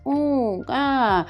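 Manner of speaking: high little infant coos; imitating baby sounds. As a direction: coo like a small baby. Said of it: "aí eles emitem sons".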